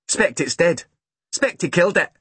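put-on high little-boy voice; unimpressed small boy. A narrator's reading. Small boy doubting his sister's claim, matter-of-fact.

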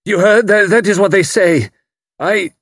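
high, loud shaken stammer; stammering outburst. A narrator's reading. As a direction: blurt it out, losing control. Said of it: "He stammered".

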